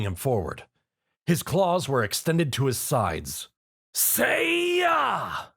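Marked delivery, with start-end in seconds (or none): 3.9-5.5 high, ringing attack shout; battle yell. A narrator's reading. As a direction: slash through with a kiai. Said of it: "Zio yelled".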